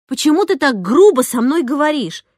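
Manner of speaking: indignant telling-off; scolding. A narrator's reading. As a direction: lay into the rude pupil. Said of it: "напустилась я на неё".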